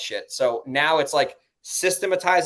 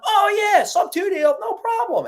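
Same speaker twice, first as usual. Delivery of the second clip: high, loud, carefree voice; sing-song no-problem voice; mock breezy yes-man; 6 dB louder, slower than usual. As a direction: play the guy who says sure, easy. Retold easy-going title company reply.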